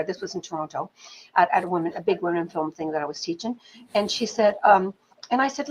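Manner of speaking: ordinary delivery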